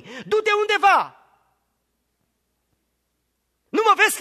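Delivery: loud, high, rising sermon climax; preaching at full force. Acted. Preacher to congregation, loud full-force preaching. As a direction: preach it out, building the list.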